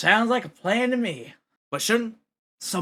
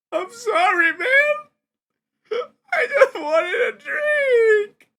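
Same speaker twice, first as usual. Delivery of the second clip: high, loud sobbing plea; crying apology; 5 dB louder, much slower than usual. Acted. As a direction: wail it out, near tears. Glenn cries apologising.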